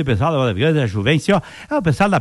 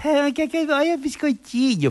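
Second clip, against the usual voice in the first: coaxing the dog; 9 semitones higher, 3 dB quieter, slower than usual. Acted.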